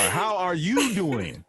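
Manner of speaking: slow, high, stretched-out greeting; playfully extended vocals. Pretend. Guest greeting the host, vocals playfully extended. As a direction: draw every word out like an on-air introduction. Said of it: "by extending my vocals right now".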